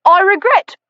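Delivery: high, prim, cut-off objection; stiff butler protest. Acted. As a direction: start a haughty refusal and get interrupted.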